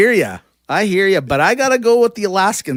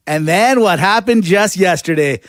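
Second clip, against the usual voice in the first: normal talking voice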